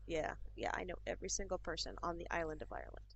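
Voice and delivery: quiet, unimpressed mock agreement; deadpan sarcasm. Irish person answering whether they know someone's friend, deadpan sarcasm. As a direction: wearily humour a silly question, softly. Retold sarcastic reply to silly question.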